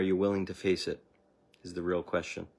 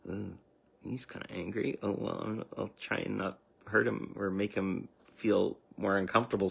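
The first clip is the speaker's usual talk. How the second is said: put-on timid old self; 5 dB quieter than usual. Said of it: "Where before you'd be just like".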